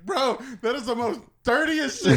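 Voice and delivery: mock disgust. Imitating friend's disgust.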